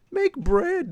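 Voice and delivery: high mock echo of the game's hint; mocking quote. Gamer mocking the game's bread hint, high-pitched mock. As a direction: scoff at the suggestion in a high voice. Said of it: "they say".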